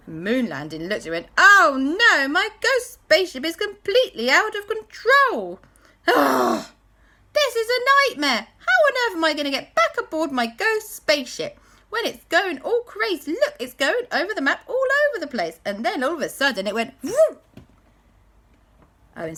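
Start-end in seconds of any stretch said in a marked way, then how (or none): 1.4-15.6 high, loud, fast panicking ghost voice; a frantic little ghost losing his ship; panicky ghost. Acted. Ghost Moon Landing panics. 17.0-17.4 a high, loud spaceship vroom; high vroom sound. Acted. Spaceship zooms off.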